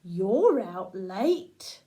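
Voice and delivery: slow, low, lightly voiced moon; drawn out like the calm old moon; the moon's voice. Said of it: "And it says".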